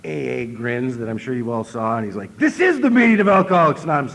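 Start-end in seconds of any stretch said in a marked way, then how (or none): 2.4-4.1 loud, high announcing; said really loud. Retold or imitated. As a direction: boom it out for the whole room. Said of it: "He said it really loud".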